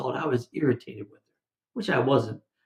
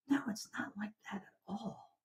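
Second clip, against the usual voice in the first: hushed whisper; 6 semitones higher, 18 dB quieter than usual. Real, not acted. Pastor rejecting the jealous thought to the church, whispered. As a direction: drop to a whisper on the rebuttal.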